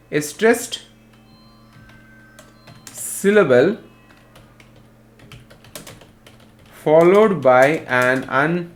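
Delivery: halting dictation with long gaps; word-by-word classroom dictation; dictating. Real, not acted. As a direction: give it out in small pieces for note-takers. Teacher dictates definition for note-taking.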